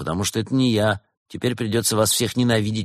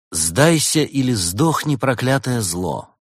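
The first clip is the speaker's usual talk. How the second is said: slow, raised, dramatic declamation; declaimed threat; 5 semitones higher, 3 dB louder, slower than usual. Acted. Detective reads out the inscription on the arrow, declaiming. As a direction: proclaim it slowly and grandly.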